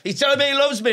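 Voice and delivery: high-pitched, exasperated retold plea; mock protest. Comic pleading with the bear during dad's reunion, mock protest. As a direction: plead over the interruption in a high voice.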